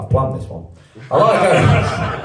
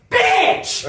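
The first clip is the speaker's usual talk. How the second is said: mock outburst. Outburst at the customer.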